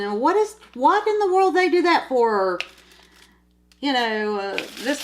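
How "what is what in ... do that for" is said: sudden, much higher and louder retold question; wife calls out to her husband, baffled by the movie, high and loud; puzzled blurted question. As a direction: call out from another room, baffled by the movie. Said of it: "all of a sudden just ask a question".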